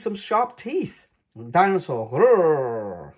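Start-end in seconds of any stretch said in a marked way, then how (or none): 1.5-3.1 loud playful growl; mock dinosaur roar. A narrator's reading. At the castle, a loud pretend roar. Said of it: "roars George".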